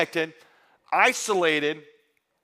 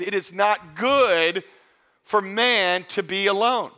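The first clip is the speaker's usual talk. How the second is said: slow, weighty quote of God's words; slow scripture quote; slower than usual. Retold or imitated. Preacher quoting the Lord to the congregation, slow and solemn. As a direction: let each word of the verse land slowly.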